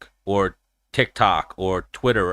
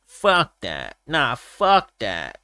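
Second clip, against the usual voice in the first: drawn-out catchphrase in imitated Diaz accent; Diaz brother; 2 semitones higher, much slower than usual. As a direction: imitate a fighter's slow dismissive drawl. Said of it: "couldn't stop saying that".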